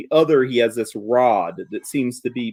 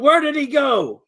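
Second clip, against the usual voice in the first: yelling; 12 semitones higher than usual. Acted. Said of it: "yells out".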